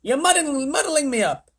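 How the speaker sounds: high, loud fluster; muddled protest. Acted. The President complaining to Showler, high and loud.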